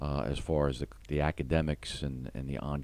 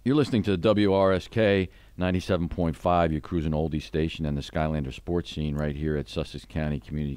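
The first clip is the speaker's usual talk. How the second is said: louder, punchy station ID voice; radio announcer read; 2 semitones higher, 5 dB louder than usual. Acted. Host reads the station ID to listeners, upbeat announcer voice. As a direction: sell it like a DJ between segments.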